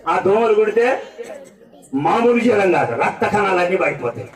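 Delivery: ordinary talk, nothing marked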